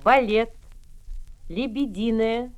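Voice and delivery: ordinary delivery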